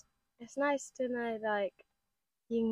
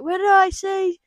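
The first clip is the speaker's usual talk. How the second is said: usual speaking voice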